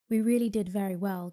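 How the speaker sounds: loud, pleased fairy exclamation; pleased and proud, projected strongly; pleased cry. A narrator's reading. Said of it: "cried the fairy in a pleased voice".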